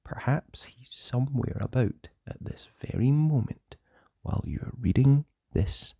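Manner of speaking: ordinary delivery, nothing marked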